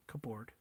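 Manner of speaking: hushed "cupboard" as cup-board; "cupboard" softly, P sounded, full "board"; every letter pronounced. Shown as a demonstration. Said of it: "You'll say cupboard".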